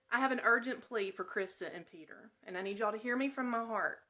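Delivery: usual speaking voice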